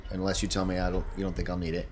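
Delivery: ordinary talk, nothing marked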